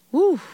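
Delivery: a high sharp "woo" of awe. Real, not acted. Preacher to congregation after Jesus' words, high woo. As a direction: whoop at a powerful line.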